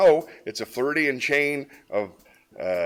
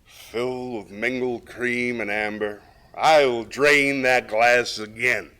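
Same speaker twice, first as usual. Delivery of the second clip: slow, slurred tipsy reading; drunken voice; 3 semitones higher, 7 dB louder, much slower than usual. Shown as a demonstration. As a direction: recite like you've had a few. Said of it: "I'm gonna do it in a slightly drunken voice".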